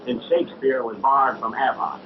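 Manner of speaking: showman hawking a free show; carnival barker. Acted. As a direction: pitch the show like a sideshow spieler. Showman spiel to the crowd.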